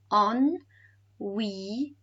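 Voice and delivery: "Ennuyeux" in separated syllables, ending lifted; tricky word broken up. Shown as a demonstration. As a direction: sound out Ennuyeux piece by piece for a learner. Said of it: "this is a tricky word to say".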